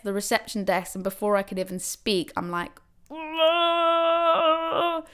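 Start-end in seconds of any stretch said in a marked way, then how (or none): 3.1-5.0 long high drawn-out whoa; mock labour groan. Woman hit by a contraction at the desk, long groaning whoa. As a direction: moan through a contraction.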